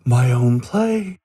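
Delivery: gasp, then slow amazed question; thrilled at the offer; delighted gasp. Acted.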